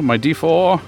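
ordinary talk

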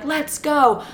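mild mock scream, high-pitched; imitated screaming. Guys hurrying the girls out, mock screaming. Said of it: "They're screaming".

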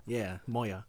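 Moya low and soft as MOY-ah. Shown as a demonstration. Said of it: "Not, not Moira".

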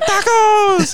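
a crowd cheering a single word; excited group shout. Retold or imitated. Joins the tacos shout.

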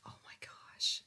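whispered oh my gosh; a voiceless, hushed oh my gosh. Real, not acted. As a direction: breathe it out in a shocked whisper. Stunned reaction, said under breath.

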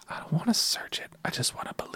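very soft, half-whispered refusal; small, hushed plea to be spared; reluctant murmur. Real, not acted. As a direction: shrink back from it, barely voiced. Refusing to look at gross picture.

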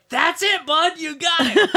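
very high, loud retold cheer; excited praise. Dad thrilled his son found the plug, high excited shout. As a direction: cheer the kid on at the top of your range.

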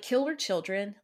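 ordinary talk, nothing marked

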